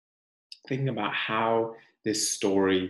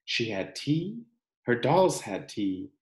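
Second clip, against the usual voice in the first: gentle, expressive picture-book reading; reading to children; 3 dB louder than usual. Acted. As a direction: read it like a storybook for the class.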